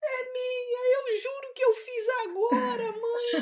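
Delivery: put-on high, tearful little girl pleading; almost crying. Girl swears to her mother the song is hers, high and tearful. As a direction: whimper it like a wronged child near tears. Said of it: "E eu quase chorando".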